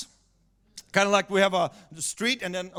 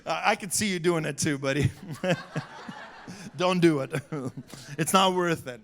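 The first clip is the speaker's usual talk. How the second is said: ordinary talk, nothing marked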